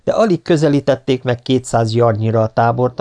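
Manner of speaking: ordinary delivery, nothing marked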